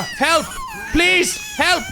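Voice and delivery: high, panicked shrieks for help; mock panic. Player fleeing a stalker, hammed-up shrieks for help. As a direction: scream for help like a hammed-up victim.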